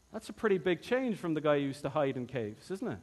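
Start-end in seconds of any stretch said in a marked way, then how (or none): none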